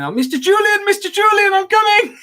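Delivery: very high, squeaky sidekick voice; eager sidekick. Retold or imitated. Local guide chasing his boss, high eager squeal. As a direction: squeal after him like a devoted helper. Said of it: "ran around following me afterwards going".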